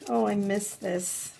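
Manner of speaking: ordinary delivery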